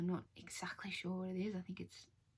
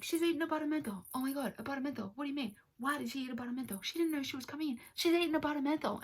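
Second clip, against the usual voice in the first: high, fast, hammed-up frenzy; mock panic; 7 semitones higher, 4 dB louder, faster than usual. Surgeon's team in a hammed-up high-pitched frenzy. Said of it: "And they're like".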